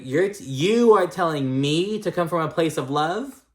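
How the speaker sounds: high, loud mock disbelief; incredulous retort. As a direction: throw it back as if you can't believe it. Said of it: "Girl, what the fuck?".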